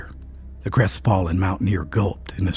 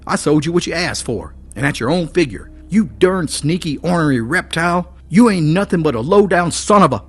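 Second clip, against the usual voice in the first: higher, louder raging mountaineer; blind rage; 9 semitones higher, 6 dB louder than usual. A narrator's reading. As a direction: rant like you've lost your head. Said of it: "out of his senses with blind rage".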